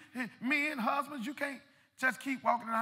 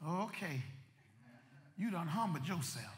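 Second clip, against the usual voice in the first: God's calm voice; 5 semitones lower, 8 dB quieter than usual. Acted. Preacher voicing God answering the humbled man, low and soft. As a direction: lower and much softer, settled and knowing. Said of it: "when God's saying".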